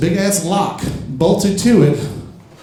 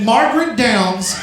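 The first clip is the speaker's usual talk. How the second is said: mock plaque reading; 8 semitones higher than usual. Comic reading grandma's plaque to the crowd, slow grand proclamation. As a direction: proclaim it like an award.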